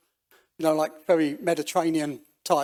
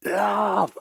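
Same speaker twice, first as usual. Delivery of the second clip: higher, louder drawn-out LOVE; retold shout; 4 semitones higher, 5 dB louder than usual. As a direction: call out LOVE as a mock yell. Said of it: "shouting at the top of my voice".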